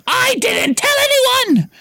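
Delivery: put-on high girl's voice, shrieking; Hermione shrieking. A narrator's reading. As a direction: shriek the denial as a hurt schoolgirl. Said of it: "Hermione shrieked".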